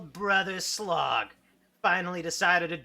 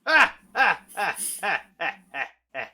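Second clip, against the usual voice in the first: tut-tutting; 3 semitones higher, 6 dB louder than usual. Acted. Villain refusing, tut-tutting.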